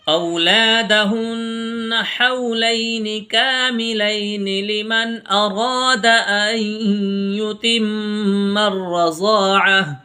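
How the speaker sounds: slow, drawn-out melodic tajweed chant; Quran recitation. Acted. Teacher reciting verses for students.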